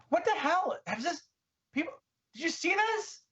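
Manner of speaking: very high alarmed squeak; high squeaky voice. Acted. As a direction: squeal it at the others. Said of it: "With the high squeaky voice".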